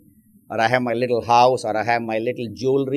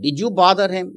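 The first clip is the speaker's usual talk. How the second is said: retold scolding question, higher and louder; mild mock yell; 8 semitones higher, 6 dB louder than usual. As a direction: lightly act out a mother yelling at her son. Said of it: "yells at the older brother".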